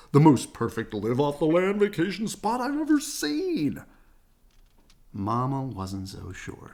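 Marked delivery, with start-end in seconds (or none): 0.1-3.8 loud, high, overjoyed father bear; excited shout. A narrator's reading. Papa seeing the shabby cabin, loud and thrilled. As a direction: cry it out with boundless delight. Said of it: "he shouted".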